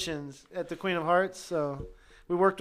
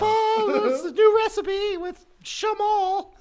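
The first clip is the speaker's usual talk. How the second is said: flustered wife voice; 10 dB louder than usual. Pretend. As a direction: make a high nervous excuse. Acting caught wife excuse.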